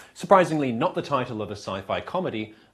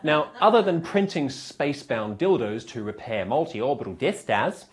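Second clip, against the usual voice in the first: usual speaking voice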